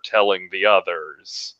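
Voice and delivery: ordinary talk, nothing marked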